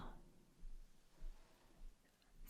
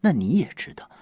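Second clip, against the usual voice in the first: ordinary delivery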